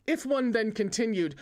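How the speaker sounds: plain reading voice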